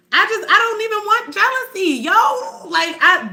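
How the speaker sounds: loud, high-pitched exasperation; real frustrated venting, loud and high; exasperated outburst. Real, not acted. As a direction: voice jumps way up, fed up. Venting about jealousy, fed up.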